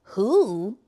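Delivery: answering the hooting owl with a confused "Who?"; puzzled reply. Retold or imitated. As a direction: ask back "Who?" in bafflement. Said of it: "And I'm like".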